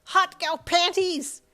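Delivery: slow high loud leering squeal; leering teen boy cry; creepy gloating. A narrator's reading. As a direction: savour every word like a pervert.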